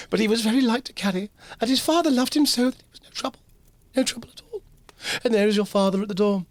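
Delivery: a fond mother remembering her son; Mrs Cratchit voice. Acted.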